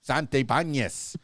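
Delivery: Santabanez said the Spanish way, Sahn-tay-PAHN-yes, in a Cuban Spanish accent. Shown as a demonstration. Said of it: "with my Cuban Spanish accent".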